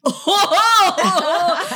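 high, loud mock "ohhh"; drawn-out teasing crowd "oh"; mimicked class uproar. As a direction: hoot like a roomful of scandalized students. Students' uproar at roll call, retold.

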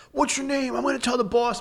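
very high, whiny mock voice of the angry man; mocking imitation. Angry man threatening to report him, mocked high.

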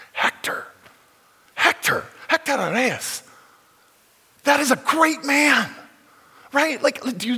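high, loud, ringing exaltation; impassioned preaching. Real, not acted. Pastor proclaims Hector's greatness to the congregation, loud and high. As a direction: proclaim his name with fervour. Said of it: "preacher exalting a man".

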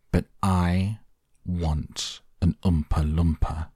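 usual speaking voice

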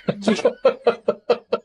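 big delighted laugh; loud high laugh. Real, not acted. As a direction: burst out laughing. Amused by the description.

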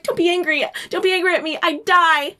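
high-pitched, hammed-up begging; melodramatic, anxious plea; desperate pleading. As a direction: plead high as the clingy wife. Wife pleading in acted quarrel.

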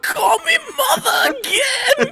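put-on high, shouted German-accented teacher; outraged German schoolteacher impression; mock German accent. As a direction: bellow it slowly in a hammed-up German accent. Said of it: "I can't do an accent".

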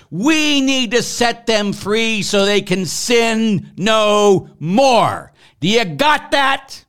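loud, high, impassioned rebuke; heated preaching. Real, not acted. Priest rebuking people who jump to condemn, heated and forceful. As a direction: preach it hot, driving every phrase hard. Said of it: "I get a little hot".

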